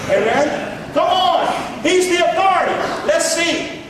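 high-pitched preacher's exclamation; real, insistent pulpit cry; emphatic urging. Real, not acted. Urging congregation after mock objection.

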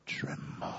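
whispered for dramatic effect; hushed whisper. Shown as a demonstration. Preacher saying what devils do, hushed dramatic whisper. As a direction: drop to a soft whisper.

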